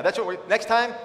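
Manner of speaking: ordinary talk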